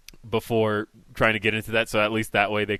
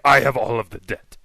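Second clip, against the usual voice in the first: odd, hammed-up character voice; put-on character. Prankster replying to debt call, put-on character voice. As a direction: play a strange caller with total conviction. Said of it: "I'm gonna start doing characters".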